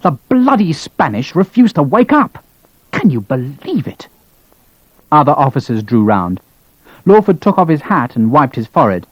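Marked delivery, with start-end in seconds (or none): 0.0-4.1 seething, slowed outrage; barely contained anger. Acted. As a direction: spit out the disbelief, struggling to hold it in. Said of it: "could barely contain his anger".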